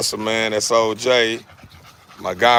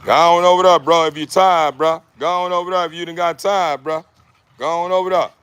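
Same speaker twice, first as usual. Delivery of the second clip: higher, louder call to send him off; 7 semitones higher, 4 dB louder than usual. Real, not acted. Owner telling his tired dog to go get water, loud call. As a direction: tell the dog to go rest, raised voice.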